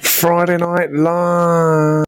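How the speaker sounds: loud, drawn-out show opening; show announcer. Real, not acted. Host opening the live show, big announcer call. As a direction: stretch each word out loudly like an announcer.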